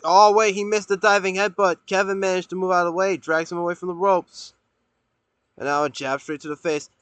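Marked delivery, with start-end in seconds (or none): 0.0-4.5 louder, higher play-by-play; wrestling commentator. Real, not acted. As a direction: call the miss with surprise. Play-by-play surge.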